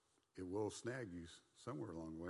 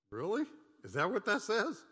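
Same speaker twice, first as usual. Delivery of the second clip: loud, high, disbelieving challenge; incredulous; 12 semitones higher, 9 dB louder than usual. Real, not acted. Preacher challenging folks who twist the verse, loud incredulous disbelief. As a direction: scoff at the misreading, much higher.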